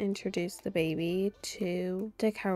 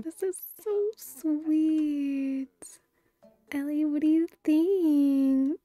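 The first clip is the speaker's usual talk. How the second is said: high, stretched cooing, louder at the end; 5 semitones higher, 5 dB louder, slower than usual. Real, not acted. Gushing and talking to baby.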